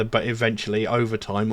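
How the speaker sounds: ordinary delivery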